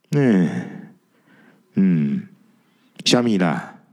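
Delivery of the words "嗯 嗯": ordinary delivery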